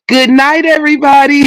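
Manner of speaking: high, sugary family goodnight; Waltons family goodnight. Retold or imitated. Mocking The Waltons family with a high sugary goodnight. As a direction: coo it like a sweet TV family. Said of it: "And in that movie".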